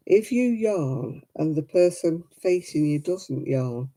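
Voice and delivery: ordinary talk, nothing marked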